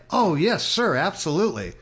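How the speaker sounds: high, loud, overeager compliance; eager yes sir. Retold or imitated. Answering the owner's son, high eager agreement. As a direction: jump at the chance, all too eager.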